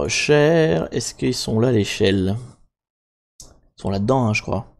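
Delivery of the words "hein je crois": ordinary delivery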